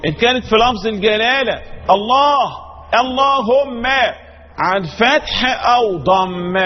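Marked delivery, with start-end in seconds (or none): 1.9-3.9 "الله" with a thick, dark L; heavy lam. Shown as a demonstration. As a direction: say "اللهم" with an emphatic L and backed vowel. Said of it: "متى تفخم؟".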